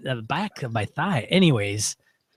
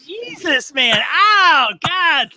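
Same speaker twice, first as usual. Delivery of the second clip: very high, agonised wail rising in volume; crying out in pain; 5 dB louder, much slower than usual. Real, not acted. Host whose leg cramp returns, wailing in real pain. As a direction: wail through a severe cramp. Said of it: "that freaking hurts".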